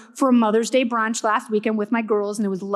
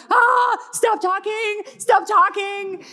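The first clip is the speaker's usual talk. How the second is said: higher, slow, exasperated stop talking; annoyed doctor brush-off; 7 semitones higher, slower than usual. Retold or imitated. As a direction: wave her off with a dragged-out groan. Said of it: "they're like".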